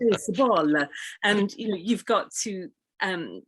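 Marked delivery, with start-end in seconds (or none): 0.0-0.7 base a ball said with an extra syllable, higher voice; light Italian accent on base a ball; imitating Italian learner. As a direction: mimic an Italian speaker adding a vowel after base. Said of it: "you might hear".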